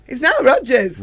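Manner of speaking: high, loud and thrilled; loud, high excited fan exclamation; starstruck. Retold or imitated. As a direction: gasp out the name like a fan. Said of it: "And you go".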